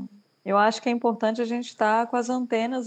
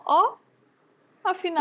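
high-pitched little gasp of discovery; acted wonder, voice lifted high; surprised realization; 8 semitones higher than usual. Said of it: "você saiu e você fala".

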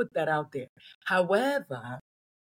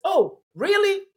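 outraged disbelief; 13 semitones higher, 7 dB louder, slower than usual. Retold or imitated. Outraged at attackers of kid.

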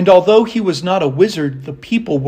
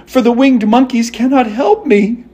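raised, mildly upset voice; disappointed Dorothy; 7 semitones higher, 4 dB louder than usual. A narrator's reading. Dorothy lamenting the wasted charm, mildly dismayed. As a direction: lament it high, without sobbing. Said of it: "ready to cry with disappointment".

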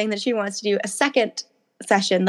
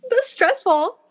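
excited; 8 semitones higher than usual. Retold or imitated. Retelling own excited reaction.

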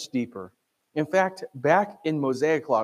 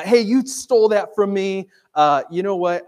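mock accusation; 6 semitones higher, 6 dB louder than usual. Acts out owner confronting the thief.

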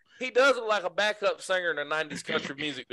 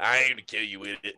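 Sling Blade; 8 semitones lower than usual. Acted. Correcting a friend's movie quote, low character impression. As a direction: quote it in a deep, deadpan voice. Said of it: "The sling, and Sling Blade".

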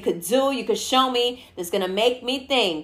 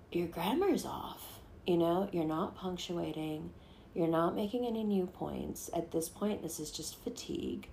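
bored deadpan reply; 3 semitones lower, 9 dB quieter than usual. Pretend. Bored reply to insults, voiced.

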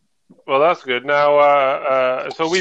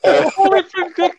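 reassuring; 9 semitones higher than usual. Retold or imitated. Reassuring screaming wife at night.

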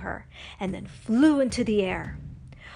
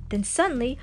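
usual speaking voice

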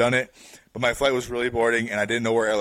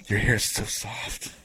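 put-on slow creepy drunk; much slower than usual. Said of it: "She goes".

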